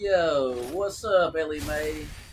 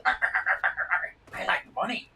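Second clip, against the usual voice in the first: slow, unvoiced put-on crab voice; Mister Krabs impression; 6 semitones higher, much slower than usual. Streamer doing Mister Krabs for chat, slow put-on voice. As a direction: drag it out as a cartoon miser. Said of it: "Did he just do a voice impersonation?".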